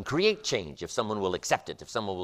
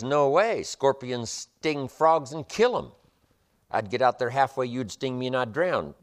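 alarmed frog; 6 semitones higher, 6 dB louder than usual. Acted. Frog refuses the scorpion.

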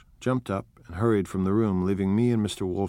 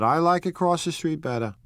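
Wolfsheim; 8 semitones higher, 3 dB louder than usual. Acted. Wolfsheim speaks.

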